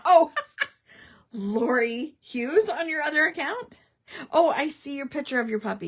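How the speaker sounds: loud delighted laugh; laughing surprise. Real, not acted. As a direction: burst out delighted.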